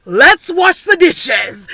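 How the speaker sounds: hammed-up call to wash the dishes; mock loud announcement. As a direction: announce it loudly and slowly so dad hears. Mocking girls' trick to dad.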